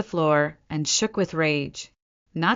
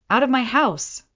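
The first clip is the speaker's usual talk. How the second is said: raised, higher voice ordering them out; voice rising; 7 semitones higher, 3 dB louder than usual. A narrator's reading. Nora ordering her parents from her house, voice rising. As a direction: push the pitch up and throw them out. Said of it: "my voice rising".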